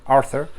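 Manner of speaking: "Arthur" retried with an English TH; "Arthur" pronounced in English, with th in the middle; English TH attempt. Shown as a demonstration. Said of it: "T H en anglais, hein, c'est chaud".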